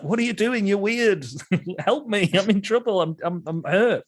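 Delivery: high bewildered pleading; the dazed dead husband pleading with strangers; confused injured man. Acted. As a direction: beg for help without knowing why they flee.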